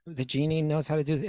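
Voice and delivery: usual speaking voice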